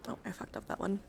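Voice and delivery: usual speaking voice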